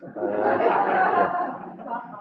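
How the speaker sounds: ordinary delivery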